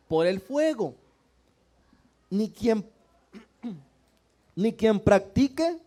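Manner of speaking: loud, measured Bible reading from the pulpit; scripture reading. Acted. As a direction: proclaim each verse to the whole church. Reads scripture aloud to the congregation. (lectura bíblica en voz alta y solemne)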